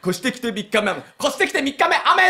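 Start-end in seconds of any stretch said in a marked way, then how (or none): 1.2-2.3 upbeat, nothing spooky; cheerful and lively. Shown as a demonstration. The landlord demanding a cheerful, lively delivery. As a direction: say it brightly and briskly. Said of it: "雨ってこう元気よくやれ".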